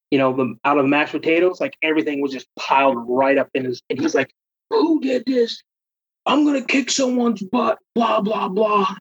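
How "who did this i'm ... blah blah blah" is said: mock angry yell. Roommate angry over piled stuff.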